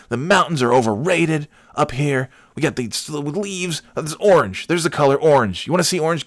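high-pitched, worked-up mock rant; flipping out. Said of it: "And I just start flipping out".

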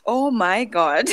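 laughing through the words, slowly; real amused disbelief, drawn out with a laugh. Real, not acted. Host laughing at the guest's sumu story, slow and amused.

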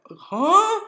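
high, loud baffled huh; stunned confusion. Retold or imitated. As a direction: mimic being completely dumbfounded. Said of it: "I froze up".

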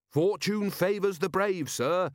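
high, slow, cheerful declaration; keen, cheery subordinate, voice lifted. A narrator's reading. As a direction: proclaim it brightly to your commander. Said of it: "said Carrot cheerfully".

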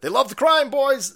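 loud, high taunting call; high-pitched, shouted jab; mocking holler. Real, not acted. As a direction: crow it out to the crowd. Sarcastic jab, then repeated slower.

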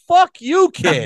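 high, loud indignant retort; retold angry outburst. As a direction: blow up at a buddy, voice shooting up. Retold angry refusal to buddy.

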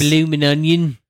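loud mock Aussie "Bloomin' onion"; Australian accent. Joking about Australians, loud mock Aussie accent. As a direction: shout it like a cartoon Australian.

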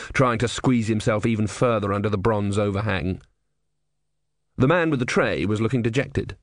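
usual speaking voice

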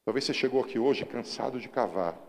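loud, driving sermon voice; fiery pulpit preaching; preaching at full force. Acted. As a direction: preach it out to the whole church.

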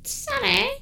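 very high-pitched comic holler; evil scientist calling. Acted. Evil scientist calls Sally from another room, comic high call. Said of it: "The evil scientist calls from another room".

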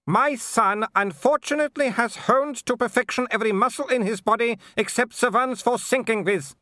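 high-pitched imperious aristocrat; scornful Baroness. A narrator's reading. Baroness scorns the threatening visitors, high and haughty. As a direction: look down on them, voice pitched far up.

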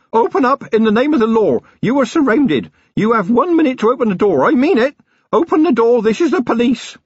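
high-pitched, forceful calling out; police raid shout. A narrator's reading. Young constable ordering the farmhouse door opened, shouting. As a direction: demand entry as a keen young officer.

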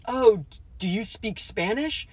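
high, eager question in a put-on voice; quoting the hero. Host quoting the movie hero, mildly voiced. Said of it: "the main character goes".

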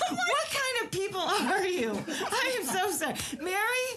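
high, laughing through apology. Real, not acted. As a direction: giggle out the apology.

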